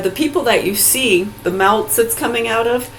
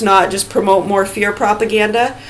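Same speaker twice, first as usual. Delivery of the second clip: ordinary delivery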